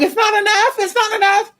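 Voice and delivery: mock his protest in a high whine; mocking the client. Mimicking the client's protest, high mocking whine. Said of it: "He's like".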